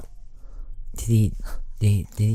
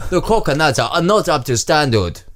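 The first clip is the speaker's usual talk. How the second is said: put-on higher comic African accent; African-sounding accent; 5 semitones higher than usual. Stranded man grumbles about food, put-on African accent. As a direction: complain in a mock African accent. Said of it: "South African".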